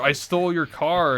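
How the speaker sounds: hammed-up confession; guest confession. Talk show guest confessing, mock voice.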